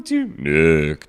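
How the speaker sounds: low spooky call; ghost voice. Acted. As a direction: moan the name low and eerie. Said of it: "I gave".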